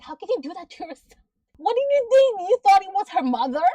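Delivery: high, loud disbelieving yell; high exasperated shout at the TV; exasperated yell at the screen. Retold or imitated. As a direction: shout in disbelief at a dumb character. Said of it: "I was like".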